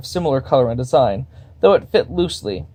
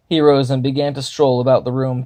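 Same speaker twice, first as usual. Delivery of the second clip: ordinary delivery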